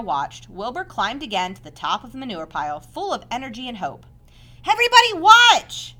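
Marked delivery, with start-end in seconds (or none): 4.6-6.0 loud, high shout for attention; crying out. Acted. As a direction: announce the leap to everyone. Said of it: "he cried".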